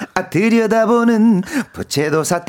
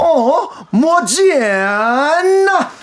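high, slowly drawn-out catchphrase; catchphrase retake; 6 semitones higher, much slower than usual. Shown as a demonstration. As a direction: drag out the last word, laughing. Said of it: "다시 한 번 더".

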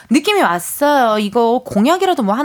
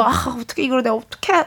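put-on flustered girlfriend, mild; 4 dB quieter than usual. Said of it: "막 약간 이런 느낌".

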